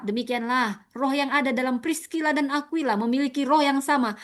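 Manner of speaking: normal talking voice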